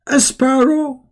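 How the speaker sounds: high, disbelieving question; incredulous. Real, not acted. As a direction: scoff at the absurd claim. Mocking disbelief at the story.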